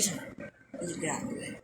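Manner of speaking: fast whispered warning; whispered threat. Real, not acted. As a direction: hiss it out low, fast and menacing.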